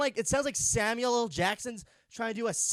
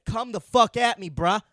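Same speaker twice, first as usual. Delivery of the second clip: mock tough-guy challenge; 8 dB louder than usual.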